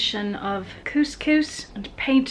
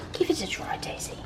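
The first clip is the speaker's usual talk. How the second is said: soft coaxing whisper; hushed encouragement to the dog; 3 semitones higher, 10 dB quieter than usual. Real, not acted.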